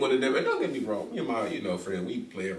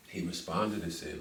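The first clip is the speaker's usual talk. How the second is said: ordinary delivery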